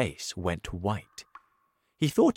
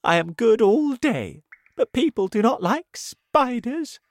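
high, loud complaining spider; 12 semitones higher, 6 dB louder than usual. Acted. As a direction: whine it high.

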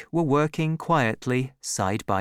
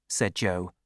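ordinary delivery

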